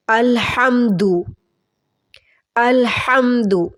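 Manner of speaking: slow अलहम्दु with a throaty ह; correct throat h. Shown as a demonstration. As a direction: stretch अलहम्दु, ह from the throat, long दु. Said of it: "अल करके नहीं पढ़ना और हा की आवाज़ हलक".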